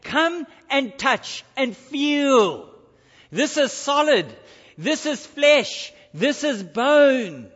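each phrase set apart, slow and weighty; slow, deliberate words of Jesus to doubting disciples; slow, weighty stress on flesh and bone; slow, emphatic invitation. Acted. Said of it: "he starts off by saying".

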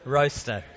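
ROASTER, rooster said with an "oh" vowel; accented mispronunciation. Shown as a demonstration. Preacher repeats his accent slip to the congregation, "oh" in "Roaster". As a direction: say "Roaster" as "ROH-ster" for rooster. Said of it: "it's the accent".

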